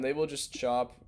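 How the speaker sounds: ordinary talk, nothing marked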